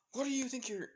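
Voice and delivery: high-pitched, cut-off objection; startled protest. A narrator's reading. Ida startled by her transformation.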